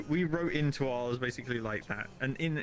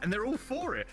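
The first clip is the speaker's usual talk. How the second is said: normal talking voice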